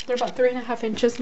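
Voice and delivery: usual speaking voice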